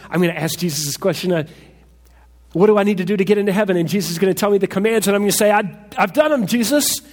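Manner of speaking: high, fast, loud eager questioner; the hopeful man rushing to ask Jesus, loud and high with excitement; eager excited man. Retold or imitated. Preacher voicing the hopeful rich man asking Jesus, high and fast. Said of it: "He was excited about meeting Jesus".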